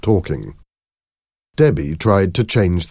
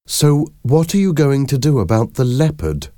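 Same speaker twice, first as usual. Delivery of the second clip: higher-pitched questioning woman character; Debbie, questioning; 9 semitones higher, 3 dB louder than usual. A narrator's reading.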